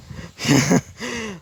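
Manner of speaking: high, strange mechanical laugh; giddy robot villain laughing at the heroes; robotic villain cackle. A narrator's reading. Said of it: "as it laughed and laughed".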